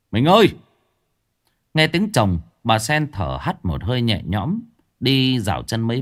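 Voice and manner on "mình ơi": loud, high call to his wife; shouting. Acted. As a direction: yell through the door. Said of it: "gọi to". (gào to gọi vợ)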